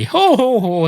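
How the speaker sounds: high, loud ho-ho laugh; hammed-up chortle; put-on jolly laugh; mock laugh. Maitre d' laughs at own phrase.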